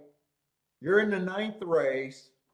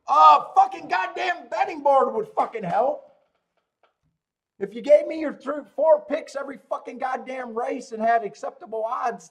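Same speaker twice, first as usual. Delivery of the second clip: loud, higher, exasperated retold yell; yelling at the screen; 6 semitones higher, 6 dB louder than usual. Podcaster yelling at the handicapper on TV, exasperated loud rant. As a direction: rant at the TV like he can hear you. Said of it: "I'm yelling at the screen going".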